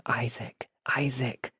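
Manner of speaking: whisper yelling. Retold or imitated. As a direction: call the name under your breath, urgently. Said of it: "I was whisper yelling my friend's name".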